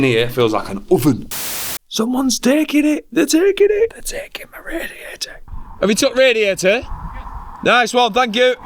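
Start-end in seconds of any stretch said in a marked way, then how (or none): 1.9-3.9 very high, thrilled squeal; excited squeal. Real, not acted. Vlogger spots radiator being taken, excited squeal. 3.9-5.4 hushed, voiceless whisper; excited whisper. Real, not acted. Vlogger watches radiator being taken, excited whisper. 5.8-8.5 high, loud call. Real, not acted. Vlogger calls to radiator collector, calling out.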